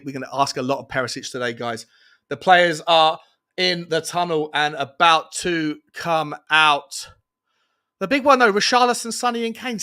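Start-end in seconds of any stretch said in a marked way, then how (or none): 2.3-7.1 slow, loud announcing voice; announcer build-up. Real, not acted. Host announcing to viewers, slow and loud. 8.0-9.8 much higher and louder, hyping the names; hyped announcement. Real, not acted. Host hyping the front three to viewers, high and loud.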